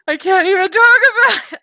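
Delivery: hammed-up squeal of someone who just finished a book; overwhelmed gush. Retelling her reply to husband.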